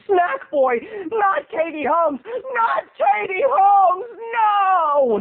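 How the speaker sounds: building to a high wailed no; slow, rising cry of disbelief; mock anguished outcry. Sympathizer wails in mock outrage.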